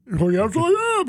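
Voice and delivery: theatrical, self-announcing reply; Gene Deckerhoff. Retold or imitated. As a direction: imitate a big-voiced broadcaster owning up. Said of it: "you know how Gene is".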